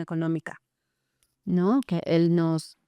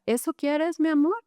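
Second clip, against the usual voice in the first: higher, honeyed coaxing of a manipulating dad; sweet coaxing father; 7 semitones higher than usual. Retold or imitated. Father pressuring his crying son on the phone, mock-sweet and higher.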